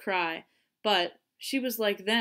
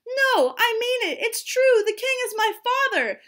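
very high, loud frantic insistence; desperate high-voiced girl's plea; pleading; 8 dB louder than usual. A narrator's reading. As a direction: beg to be believed. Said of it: "she pleaded".

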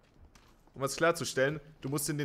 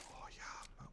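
whispered relief; 21 dB quieter than usual. Real, not acted. Quiet relief finding gun.

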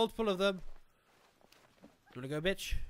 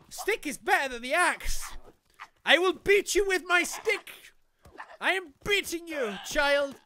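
high, loud gamer yelling; hammed-up high-pitched threat; mock battle cry; 8 semitones higher, 6 dB louder than usual. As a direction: holler it like a crazed stick fighter. Mock battle cries while swinging stick.

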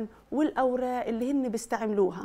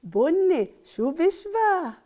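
louder, higher slow quiz; excited question; 6 semitones higher, 6 dB louder, slower than usual. Acted. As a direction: tease the answer out of kids. Quizzing children.

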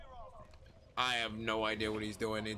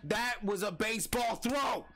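wrestling commentator; 9 semitones higher than usual. Acted. Hyped call of a grenade.

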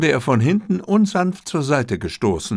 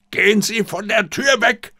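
high, raised command; bellowed order; 10 semitones higher than usual. A narrator's reading. Katayama orders the cook away from the door. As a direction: bark it at the man blocking the door. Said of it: "brüllte ihn Katayama sofort an".

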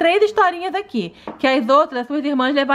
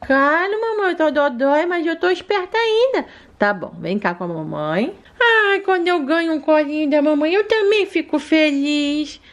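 sweet little daughter asking mommy for more; little girl voice; 3 semitones higher than usual. Acted. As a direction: play the sick little girl talking to mom. Amandinha doll answering mom.

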